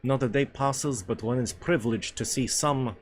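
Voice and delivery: put-on hammy Russian accent, deliberate pace; Russian character. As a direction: play the hearty Russian dinner companion.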